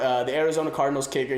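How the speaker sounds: usual speaking voice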